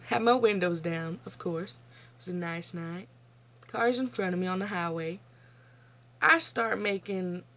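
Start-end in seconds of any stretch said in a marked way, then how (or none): none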